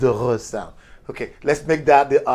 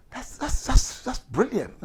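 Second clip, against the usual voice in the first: acted admiration; 11 semitones higher, slower than usual. Acted admiration of new language.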